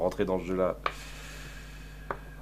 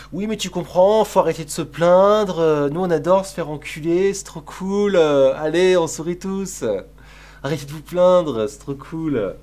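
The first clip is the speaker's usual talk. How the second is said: voix sarcastique plus aiguë et plus forte; ironie mordante; 5 semitones higher, 7 dB louder than usual. Pretend. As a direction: faux enthousiasme appuyé. Sarcastic fake cheer about censorship. (jouer le béni-oui-oui ravi, sarcastique)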